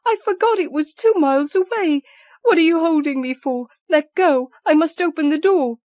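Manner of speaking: high frantic struggling voice; frantic pleading. Acted. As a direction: fight her way to the door. Mother struggling to reach door.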